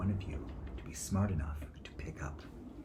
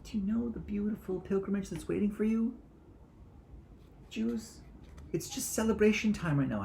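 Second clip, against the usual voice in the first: much higher, lightly voiced joyful announcement; mock celebratory herald; 4 dB louder than usual.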